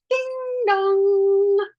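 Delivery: high, loud doorbell chime; doorbell ding dong. Retold or imitated. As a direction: sing the bell like a chime. Imitates the doorbell.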